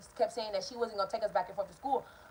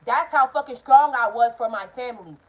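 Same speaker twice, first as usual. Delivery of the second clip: emphatic; 2 semitones higher, 12 dB louder than usual. Real, not acted. Proud emphatic declaration.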